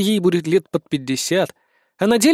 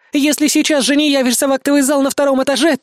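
high, strained woman's voice; scared young woman forcing out a threat; frightened recital; 9 semitones higher, 4 dB louder than usual. A narrator's reading. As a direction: push the words out in rising panic. Terrified hostage repeats the message.

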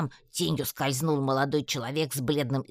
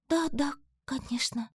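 absent-minded; 12 semitones higher than usual. A narrator's reading. Алька gazes at the spark, distracted higher voice. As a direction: agree without listening. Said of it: "рассеянно произнесла Алька".

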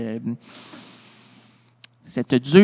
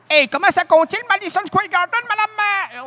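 much higher, pleading question; high eager question; 12 semitones higher than usual. Retold or imitated. Comic asking his partner the crowd size, high and eager. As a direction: pitch it up high like an eager kid.

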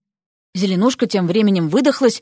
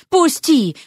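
higher, mildly indignant cry; indignant demand; 5 semitones higher than usual. A narrator's reading. Vasya first demands release from the hat, indignant. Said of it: "Шапка негодовала".

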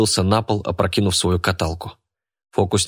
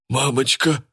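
frightened squeak; 5 semitones higher than usual. A narrator's reading. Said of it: "испуганно пролепетал бильярдный шар".

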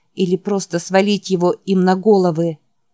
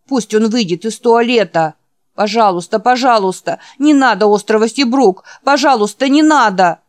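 raised, louder begging voice; frantic pleading; 4 semitones higher, 6 dB louder than usual. Acted. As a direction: beg desperately from inside a nightmare. Feverish boy pleads in nightmare. (отчаянная мольба)

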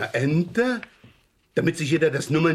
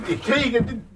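straining effort; 12 semitones higher than usual. Acted. Straining to lift heavy sack.

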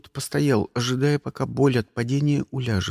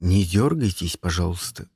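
low-pitched, mild henchman; polite request; 8 semitones lower than usual. A narrator's reading. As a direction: ask meekly in a low voice. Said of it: "попросил Карл Диану". (вежливо просит низким голосом)

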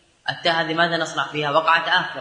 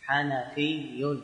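slow and much softer; slow demonstration; 7 dB quieter, much slower than usual. Shown as a demonstration. Teacher repeating the form quietly for students, slowly. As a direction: repeat it slowly, almost to yourself. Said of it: "فتقول".

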